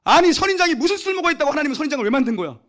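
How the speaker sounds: high, loud exasperated shout; mock rant at creation. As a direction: rage at the pointless cactus. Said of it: "어디로 가요".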